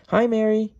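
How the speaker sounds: high, loud hello called across; acted yell, pitch way up; yelled greeting. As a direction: call out brightly to a friend at a distance. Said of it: "John sees Mary and yells".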